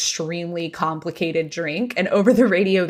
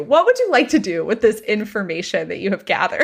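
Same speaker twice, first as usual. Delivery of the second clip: ordinary talk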